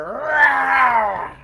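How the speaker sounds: loud, high shouted roar; fierce bear roar. Acted. Three bears roaring at the sleeping girl, loud roar.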